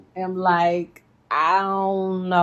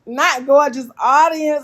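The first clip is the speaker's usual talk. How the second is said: sassy correction; 6 semitones higher, 5 dB louder than usual. Real, not acted.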